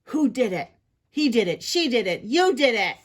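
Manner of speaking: loud, punchy finger-pointing; squabbling accusations; accusing. Acted. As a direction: throw the blame around loudly. Crowd trading accusations.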